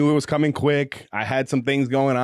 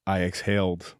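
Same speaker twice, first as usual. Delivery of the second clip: slow, low romance narration; audiobook narration; 8 semitones lower, 6 dB quieter, slower than usual. Acted. Host reading a spicy romance passage aloud, measured narration. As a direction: read it like a slow, intimate audiobook.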